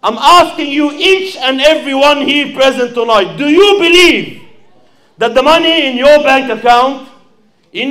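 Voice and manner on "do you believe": ordinary delivery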